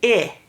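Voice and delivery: short clipped "I" as in pig; short I sound. Shown as a demonstration. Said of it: "changes to its short sound".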